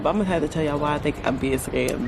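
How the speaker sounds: ordinary delivery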